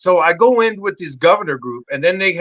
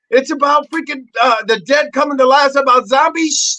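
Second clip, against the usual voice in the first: excited explaining; 9 semitones higher, 3 dB louder than usual. Retold or imitated. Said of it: "She goes".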